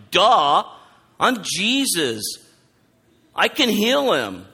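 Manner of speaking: loud, high, drawn-out "duh" delivery; Jesus, exasperated duh. Retold or imitated. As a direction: state the blindingly obvious, slowly. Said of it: "It's a duh moment. Jesus is like".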